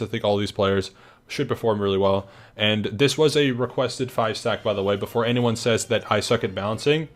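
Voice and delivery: ordinary delivery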